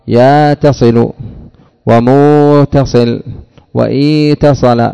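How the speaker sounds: يا تصل, موتصل, إيتصل said slowly, each one spelled out; slow teaching demo of the variant forms; dialect forms. Shown as a demonstration. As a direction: list the Hijazi variants one by one. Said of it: "فيقال".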